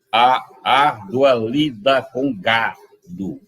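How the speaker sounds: very slow, every syllable stretched; scanning the verse. Shown as a demonstration. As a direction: count the metre aloud with the voice. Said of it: "perfeitamente metrificado". (escandindo o verso bem devagar)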